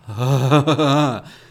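big loud burst of laughter. Real, not acted. Vlogger laughing loudly at management jargon.

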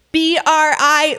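letters said slowly one by one; spelling it out. Shown as a demonstration. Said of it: "like, spelling it out".

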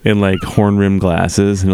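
ordinary delivery

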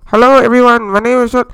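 blaring, distorted cheap karaoke mic; old microphone sound. Shown as a demonstration. Host demonstrating old episode audio, obnoxiously loud distorted mic. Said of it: "sounded like this".

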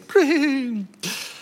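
high whinnying neigh; horse neigh. Acted. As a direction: neigh like a joyful unicorn. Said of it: "And then he neighs".

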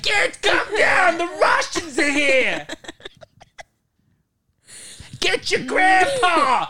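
high, loud, drawn-out Bronx screech; Italian Bronx woman. Acted. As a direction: scream like a hammed-up Italian Bronx mom. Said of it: "it's a family".

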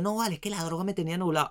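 mock junkie's excuse, fast and high; mocking robber excuse. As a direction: parody a thief blaming the drugs. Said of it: "se drogan pa' robar y dicen".

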